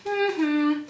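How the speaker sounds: high two-note hum, imitating the girl; sustained high hum; hummed call. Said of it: "copy me".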